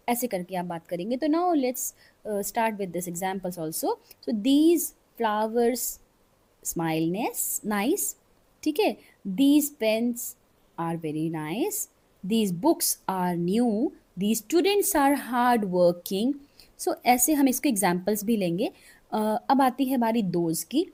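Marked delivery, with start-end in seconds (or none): 4.4-16.3 slow dictation. Shown as a demonstration. Teacher slowly dictating example sentences.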